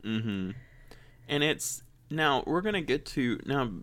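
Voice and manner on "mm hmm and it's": usual speaking voice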